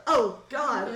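loud, laughing "oh god"; mock dismay. Bouncer catching sight of a very mad guest, comic dismay. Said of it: "kinda does a".

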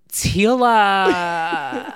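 high, loud, long-drawn call of a name; over-the-top starstruck holler; exaggerated cry. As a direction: draw the name out hugely, high and creaky. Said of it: "I said it in a very exaggerated".

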